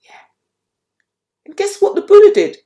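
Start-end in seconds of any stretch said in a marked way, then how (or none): 1.5-2.6 excited teaser. A narrator's reading. Storyteller teases listeners.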